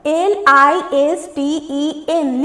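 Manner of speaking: slow letter-by-letter reading; spelling out. Shown as a demonstration. Teacher reading the ninth word's spelling, spelling out. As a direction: spell it slowly, letter after letter. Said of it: "जिसके में silent क्या है?".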